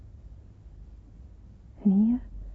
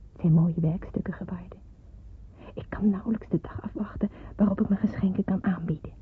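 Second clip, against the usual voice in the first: ordinary talk, nothing marked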